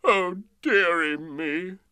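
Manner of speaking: hammed-up sobbing king; mock sobbing. A narrator's reading. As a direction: draw it out in hammed-up misery. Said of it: "The King sobbed".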